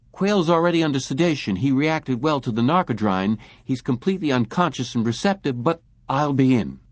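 a nervous tech rushing the news; urgent technician on intercom. A narrator's reading.

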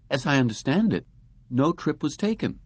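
put-on high, composed young woman; smooth receptionist brush-off. A narrator's reading. Receptionist voiced.

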